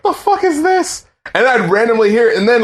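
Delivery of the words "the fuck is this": high squeaky disbelief; pitched way up, mock-baffled. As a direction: squeal it like the song makes no sense. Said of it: "And I was like".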